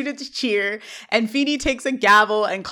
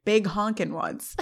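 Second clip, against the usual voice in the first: softer, slow deadpan echo; dry repeat; 5 dB quieter, slower than usual. Retold or imitated. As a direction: repeat it quietly and unimpressed, drawn out. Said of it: "turns back to Turner and repeats".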